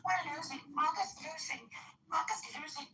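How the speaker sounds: high, squeaky mock whine repeating the phrase; mocking whiny imitation. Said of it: "you come in here and you're like".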